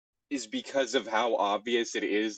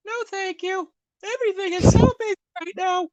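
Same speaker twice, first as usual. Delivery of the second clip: much higher put-on girly voice; high feminine voice; 8 dB louder than usual. Shown as a demonstration. Joking about feminizing the voice, high falsetto. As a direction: flip into a squeaky falsetto. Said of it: "feminizing my voice".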